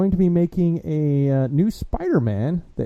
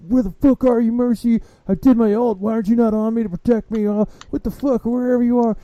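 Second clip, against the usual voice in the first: whiny gamer; 11 semitones higher than usual. Retold or imitated. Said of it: "He's like".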